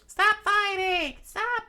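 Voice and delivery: loud, very high falsetto shriek; drawn-out acted princess scream. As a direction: scream slowly to a crowd below. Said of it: "She's screaming out".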